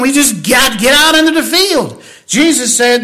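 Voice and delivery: high, loud, full-force preaching climax; fiery preaching. Acted. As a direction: preach it out, rising and driving hard.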